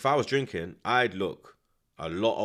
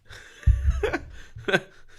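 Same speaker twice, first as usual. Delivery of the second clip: sudden high-pitched burst of laughter; loud amused laugh; 4 dB louder than usual. Real, not acted. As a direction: cackle at the joke. Laughing at friend's reply.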